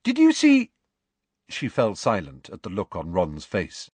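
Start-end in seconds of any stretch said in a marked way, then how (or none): 0.0-0.7 high, fast, bubbling; eager chatter. A narrator's reading. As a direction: rush out her holiday memory.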